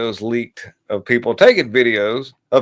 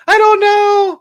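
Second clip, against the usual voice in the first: very high, loud falsetto shrug; ditzy girl shrug; 6 dB louder, slower than usual. Pretend. Mocking the TikTok girl dodging the question. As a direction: answer like a clueless TikTok girl. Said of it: "why'd you roll your tongue".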